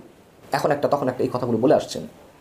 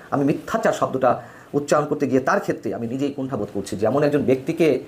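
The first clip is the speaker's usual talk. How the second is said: usual speaking voice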